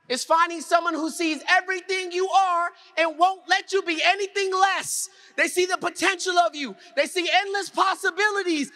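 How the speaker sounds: high-pitched, rousing oratory; impassioned, declaiming to the whole room; motivational preaching. Real, not acted. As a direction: build it like a keynote climax. Motivational climax in speaker's oration.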